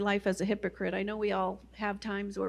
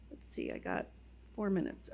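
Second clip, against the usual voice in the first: muttered aside; 3 semitones lower, 9 dB quieter than usual. Real, not acted. Aside checking the time.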